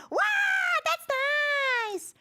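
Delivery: high squealed mimicry; cutesy copycat squeal. Streamer copying what a collab partner says, cutesy mimicking squeal. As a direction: copy a cute friend's high squeal. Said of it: "I copy what they say".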